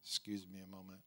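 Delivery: aside through a choking throat; softly, between coughs. Real, not acted. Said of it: "Sorry for my choking and gagging this morning".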